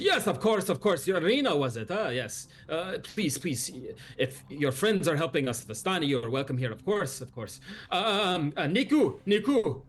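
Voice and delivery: bustling host welcoming and calling out; eager Luvash. Acted.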